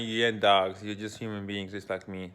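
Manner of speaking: ordinary talk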